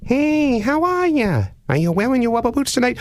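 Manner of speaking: high, sing-song creepy caller voice; odd prank caller. Retold or imitated. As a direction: play the weird caller way up high. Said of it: "the voice at the other end says".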